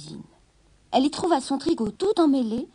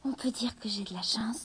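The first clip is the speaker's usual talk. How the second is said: soft whispered inner thought; 7 dB quieter than usual. A narrator's reading. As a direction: whisper it to yourself. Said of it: "songea-t-il".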